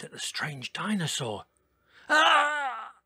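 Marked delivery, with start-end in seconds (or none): 2.1-2.9 sudden shrill yelp of fright; a startled high scream; high frightened cry. Acted. Lenny sees the strange dinosaur, crying out in fright. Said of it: "he cried".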